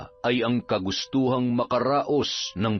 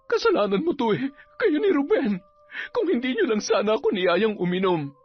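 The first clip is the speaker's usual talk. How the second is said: higher, fast accusing woman's voice; upset woman; 10 semitones higher, faster than usual. Acted. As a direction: blame him, close to tears. Ellie blaming Ryan.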